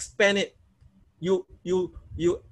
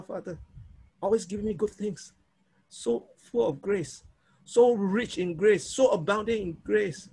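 heartfelt prayer; 3 semitones higher than usual. Shown as a demonstration. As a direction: pray it from the heart, softening into awe. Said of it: "That's how you pray".

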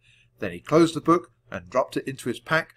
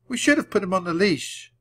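high-pitched regretful lament; wailing; 8 semitones higher, 3 dB louder than usual. A narrator's reading. Annie regrets losing the puppy, mildly wailing. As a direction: lament the mistake. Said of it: "wailed Annie".

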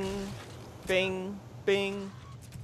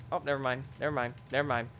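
usual speaking voice

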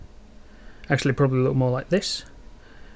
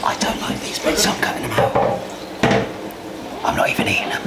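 unvoiced whisper; whispered aside. Real, not acted. Hushed aside while filming the food.

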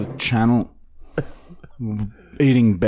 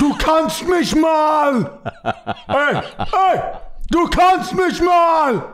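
put-on old German man shouting; 14 semitones higher, slower than usual. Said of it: "like an old man German".

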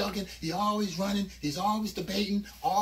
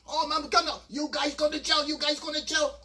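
threatening owner; 6 semitones higher than usual. Retold or imitated. Owner threatening the thieves with jail. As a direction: warn them of jail.

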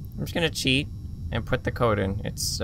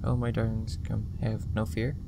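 quietly voiced spirit; 4 semitones lower, 8 dB quieter than usual. Shown as a demonstration. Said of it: "This is what I got".

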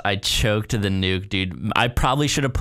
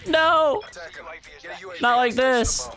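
despairing; 10 semitones higher, much slower than usual. Real, not acted. Losing the streak.

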